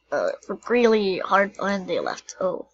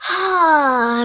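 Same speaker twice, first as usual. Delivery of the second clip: loud ah on return; loud exclamation; 7 semitones higher, 8 dB louder than usual. Real, not acted. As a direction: let out a loud ah. Back from break, cry.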